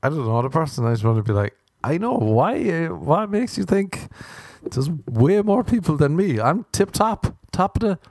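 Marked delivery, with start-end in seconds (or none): 1.8-8.0 1 mock cheerful. Mock brave reply to stranger.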